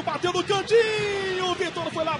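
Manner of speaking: sudden excited yell on the shot. Real, not acted. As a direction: jump up as the ball flies. Shot on goal, rising excitement.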